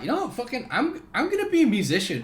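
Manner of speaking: high-pitched mock midlife-crisis rant; hammed-up newly divorced dad announcing a big decision; divorced man. Divorced man telling his ex his new career, mock defiant. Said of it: "he's just got divorced and he's like".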